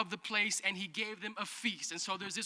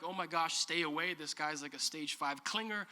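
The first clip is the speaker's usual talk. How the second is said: put-off aside; 5 semitones lower, 7 dB quieter than usual. Retold or imitated. Imitating a put-off girl.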